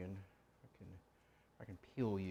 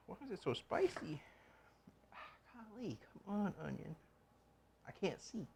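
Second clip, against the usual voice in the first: mock crying; 8 semitones higher, 12 dB quieter than usual. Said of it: "I'm crying now".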